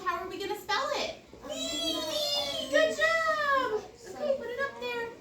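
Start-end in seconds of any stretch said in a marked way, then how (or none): 1.5-5.1 much higher, bright praise. Real, not acted. Teacher to child at board, high praising voice.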